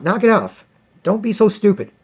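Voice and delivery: normal talking voice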